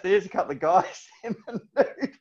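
laughing through the words; can't hold back real laughter mid-sentence; breaking into giggles while telling it. Real, not acted. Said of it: "so I just started laughing".

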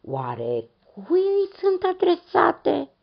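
put-on a high, wondering old voice; old man asking. A narrator's reading. Old man asks who the letters are for, high and curious. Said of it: "întrebă".

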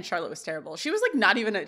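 ordinary talk, nothing marked

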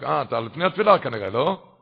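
loud scornful rebuke; scolding shout. Retold or imitated. Rosh yeshiva yells at the rebbe before prayers, loud scorn. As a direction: bark it at him as a reproach. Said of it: "הוא צעק, התחיל לצעוק".

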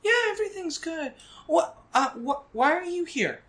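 put-on high, quick girl's voice; a kid brushing it off, then curious; young girl.

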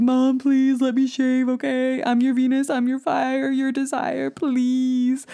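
high, slow, sing-song begging; pleading whine. Retold or imitated. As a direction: beg like a whiny kid singing. Begging mom, singing line.

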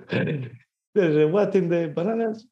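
a hammed-up, puzzled Brit; British accent. Acting the husband's surprise in a mock British accent. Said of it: "I can't do British".

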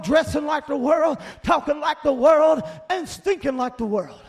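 loud rhythmic sermon cadence; forceful preaching. Real, not acted. Preacher warning of people who went back to the world, forceful preaching. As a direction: hammer each item of the list at the congregation.

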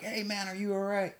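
a passing old man checking on him, in a hint of a kindly voice; old timer asking. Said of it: "And he was like".

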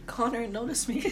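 high, soft lovestruck plea; mock-swoon over Connor; swooning girl voice. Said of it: "she was like".

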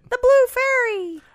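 high slow storybook voice; childlike voice. Acted. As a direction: say it like a wide-eyed child. Joining Pinocchio voices.

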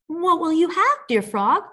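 high-pitched princess asking; eager princess. A narrator's reading. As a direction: ask high and sweetly as a princess. Princess bargains with the frog.

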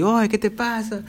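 reenacted high-pitched plea for help; mock cry for help. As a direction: call for mamá in mild mock panic. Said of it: "pedía ayuda, literalmente".

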